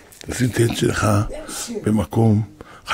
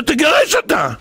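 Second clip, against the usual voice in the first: shouted order; 11 semitones higher than usual. Retold or imitated. Rosh yeshiva repeating the order to the husband, shouting. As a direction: bark the order.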